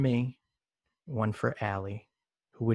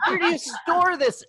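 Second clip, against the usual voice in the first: much higher, giggly put-on voice; silly high voice; 10 semitones higher than usual. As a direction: play it as a ditzy high character. Silly high bit with cohost.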